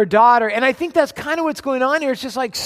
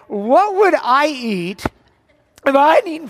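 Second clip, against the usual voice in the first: dreamy musing; 4 semitones higher, 3 dB louder than usual. Shown as a demonstration. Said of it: "I was just imagining".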